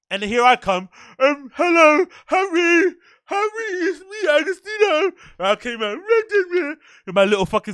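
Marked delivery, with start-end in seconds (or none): 1.2-5.1 high, breathless fanboy squeak; nervous starstruck fan. Retold or imitated. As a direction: gush at him like a starstruck kid. Nervously greeting a famous crush.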